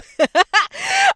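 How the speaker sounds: high squealing laugh; loud shrieking giggle; burst of laughter. Real, not acted. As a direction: crack up loudly. Laughing at own story.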